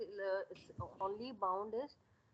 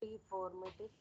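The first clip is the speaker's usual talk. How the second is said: barely audible; 7 dB quieter, slower than usual. Real, not acted. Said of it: "Your voice is very, very low".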